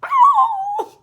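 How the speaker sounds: very high, loud mock dog yowl; dramatic beagle yowl, high and loud; imitating a beagle howl. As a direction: howl it like the beagle was injured. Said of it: "And Pinocchio goes".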